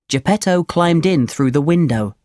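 ordinary delivery, nothing marked

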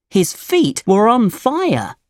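ordinary delivery

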